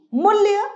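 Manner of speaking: मूल्य pitched high, rising; wrong form. Shown as a demonstration. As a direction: lift मूल्य up as the wrong spelling. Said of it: "गलत है".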